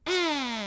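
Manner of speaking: high mock turtle cry; turtle mating moan. Imitates turtles at the zoo.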